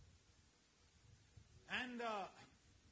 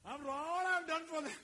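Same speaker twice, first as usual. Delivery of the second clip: much higher, loud indignant shout; Moses' outraged cry; 13 semitones higher, 14 dB louder than usual. Acted. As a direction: bellow the grievance at God. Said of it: "Moses could have said".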